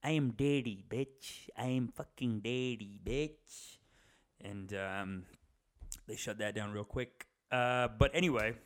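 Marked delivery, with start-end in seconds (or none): 0.0-3.8 put-on swaggering bully voice, dropping to a sneer; cocky put-on voice claiming to be daddy; smug America voice. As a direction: lord it over them as top dog. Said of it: "the Americans are like".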